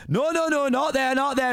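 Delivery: high, urgent objection; acted panicked protest. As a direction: cry out to stop them going in. Shrek stopping intruders at door.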